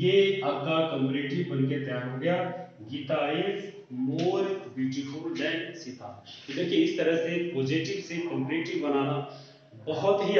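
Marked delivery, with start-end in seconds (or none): 2.9-6.1 dictating the answer so students can copy; slow dictation. Shown as a demonstration. Teacher to students writing the answer: slow dictation. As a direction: read it out very slowly, word by word.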